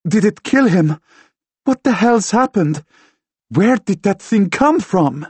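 high, loud, alarmed questions; frantic questioning. A narrator's reading. Woman finds friend unconscious after ghost attack, frantic questioning. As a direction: demand answers in a panic.

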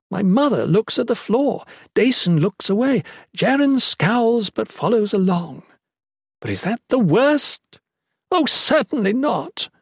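high, anguished indignation; voice cracking. A narrator's reading. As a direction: let hurt crack through the anger. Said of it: "Her voice was cracking now".

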